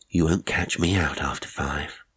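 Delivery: ordinary delivery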